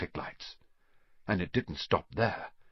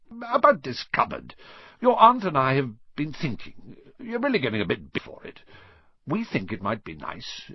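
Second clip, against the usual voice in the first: forced friendliness; 7 semitones higher than usual. A narrator's reading. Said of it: "forced his face into a smile".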